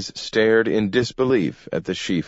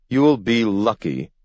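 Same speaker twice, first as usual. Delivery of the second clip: a higher-pitched, snapped retort; snapping; 4 semitones higher than usual. A narrator's reading. Webster answers the mayor about his policemen, snapping. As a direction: retort with a touch of sharpness. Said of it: "snapped Webster".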